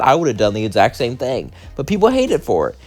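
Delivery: usual speaking voice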